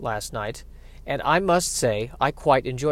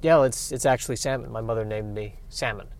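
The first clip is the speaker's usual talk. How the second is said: quiet salmon voice; 5 semitones lower, 7 dB quieter than usual. Acted. Salmon character.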